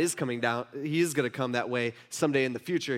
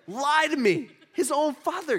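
high-pitched, indignant disbelief; mock outrage; 10 semitones higher, 3 dB louder than usual. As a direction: play the scandalized father. Mock outrage at son's lie.

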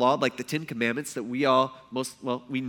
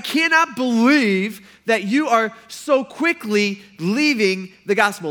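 exasperated rebuke; 5 semitones higher, 7 dB louder, slower than usual. Acted. Preacher voicing Paul's rebuke.

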